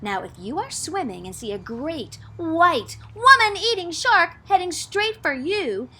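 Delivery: usual speaking voice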